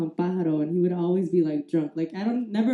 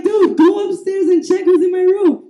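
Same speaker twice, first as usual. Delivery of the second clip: loud, high-pitched pleading; re-enacted frantic request; urgent plea; 9 semitones higher, 7 dB louder than usual. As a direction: send big brother upstairs in a fluster. Pleading with big brother.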